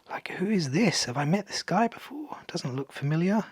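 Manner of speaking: puzzled whisper to self; hushed, wondering under breath. Real, not acted. Puzzling over an unknown card.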